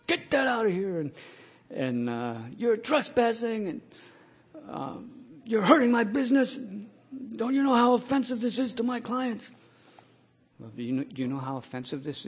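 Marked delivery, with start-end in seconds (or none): 0.1-9.5 mock angry boss, pitched high; lightly imitating an irate manager; furious manager. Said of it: "he was furious".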